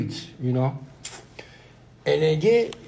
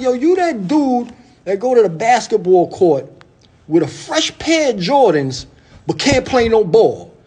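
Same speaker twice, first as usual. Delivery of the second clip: much higher, teasing mentor voice; mentor's mocking voice; 7 semitones higher, 3 dB louder than usual. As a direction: pitch way up to play the roasting mentor. Mentor roasting him, voiced high.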